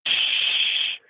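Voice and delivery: whispered shush; hushing shh. Retold or imitated. Toddler hushing the whole visiting room, whispered shush. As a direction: hush the room with a finger up. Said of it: "putting his finger in front of his face".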